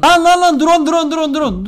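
high, loud rapid repetition; panicked alarm. Real, not acted. Player spotting a drone, panicked yelling. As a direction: yelp the warning in a panic, then ease off.

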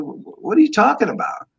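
high, startled retold reply; shocked disbelief. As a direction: suddenly jump up in pitch, disbelieving. Retelling his shocked reply to Ditka.

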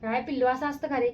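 high, coaxing mum voice; mother coaxing her crying daughter during nail paint. Real, not acted. Mother soothing crying baby.

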